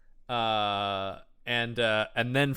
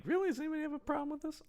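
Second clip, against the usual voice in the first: tiny, high, hushed quick voice; mock-innocent aside; 13 semitones higher, 12 dB quieter, much faster than usual. Host shrugging off the villains getting killed, high soft mock-innocent aside. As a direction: squeak it softly as a rhetorical question. Host's own aside about the killings.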